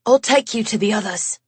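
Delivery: lightly voiced English man, fast; a hint of English accent, hurrying; light English accent, moving quickly. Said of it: "that English accent of his".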